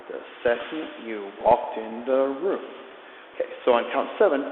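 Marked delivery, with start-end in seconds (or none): 0.1-2.6 slow lyric, drawn out to the beat; slow spoken lyric. Shown as a demonstration. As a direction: let the line stretch over the counts. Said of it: "I'm just gonna speak the lyrics".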